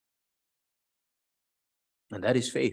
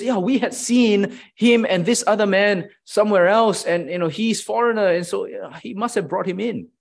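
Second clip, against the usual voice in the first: lightly voiced accusers, a bit higher; assuming accusers; 6 semitones higher, 4 dB louder than usual. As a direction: jump to conclusions out loud. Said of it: "He says".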